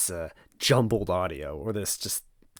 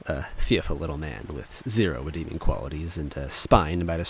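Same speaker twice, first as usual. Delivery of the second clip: lower, measured first-person storytelling; story narration; 4 semitones lower, 4 dB louder than usual. A narrator's reading. Reading the Mario is Missing chapter to viewers, lower measured narration.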